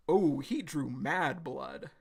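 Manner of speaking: normal talking voice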